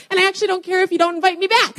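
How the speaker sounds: high, fast joking outburst; playful I-don't-care shout; mock defiance. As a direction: blurt it out cheekily, about to crack up. Joking mock defiance, bursts into laughter.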